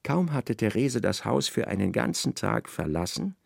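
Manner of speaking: normal talking voice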